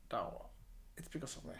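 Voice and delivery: low, hushed self-confession; quiet admission. Acted. Someone facing the mirror taking the blame, quiet admission. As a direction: own up softly, voice dropped low.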